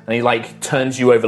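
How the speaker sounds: ordinary talk, nothing marked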